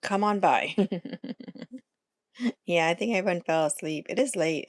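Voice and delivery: ordinary talk, nothing marked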